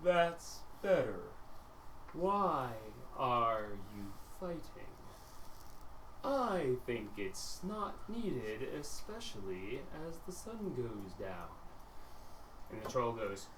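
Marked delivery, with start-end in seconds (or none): 0.0-11.5 calm menace. Acted. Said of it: "And Mister Tabs goes".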